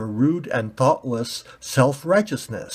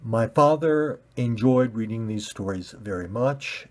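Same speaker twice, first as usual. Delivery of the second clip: plain reading voice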